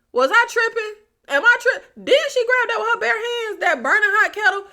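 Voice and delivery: can't believe what you saw; stunned disbelief. Real, not acted. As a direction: reviewer doubting her own eyes, high and incredulous. Disbelief at bare-handed kettle grab.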